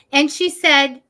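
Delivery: normal talking voice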